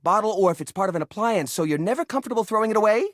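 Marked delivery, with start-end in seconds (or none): none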